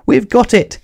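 joyful little engine shouting in relief; happy cry. Acted. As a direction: cheer as the kite is caught. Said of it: "smiled Thomas".

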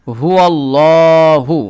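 slow, drawn-out chanted Allahu; tajweed demo, chanted; recited slowly. Shown as a demonstration. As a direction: stretch আল্লাহু long and full. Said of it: "হা পেশ হু".

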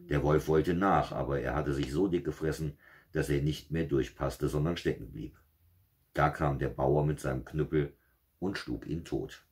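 steady fairy-tale reading voice; story narration. A narrator's reading. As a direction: read it like a bedtime fairy tale. Märchen vorgelesen. (Märchen vorlesen, ruhig erzählt)

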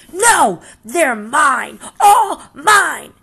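shrieking high and loud with a tantrum wail; screaming. Acted. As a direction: scream it like a greedy toddler. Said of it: "screamed the grouchy ladybug".

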